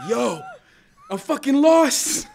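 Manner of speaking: loud, high drawn-out yell; re-enacted shout for help; calling out. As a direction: holler to armed strangers from a distance.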